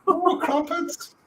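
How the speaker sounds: very high, slow mock posh "crumpets"; hammed-up British.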